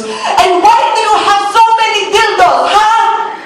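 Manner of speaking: put-on high accusing question in a thick Filipino accent; thick Filipino accent, high and baffled; confused angry Filipino mom. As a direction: Filipino mom confronting her daughter. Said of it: "and she goes".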